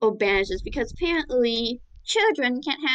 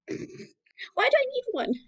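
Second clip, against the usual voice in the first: put-on childish whine; 13 semitones higher, slower than usual. Child character complaining.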